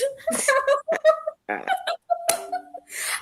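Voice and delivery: long high cackling laugh that cracks; real laughter breaking up at her own joke; helpless laughter. Real, not acted. Host laughing at her own lawyer joke to the co-host, helpless giggling.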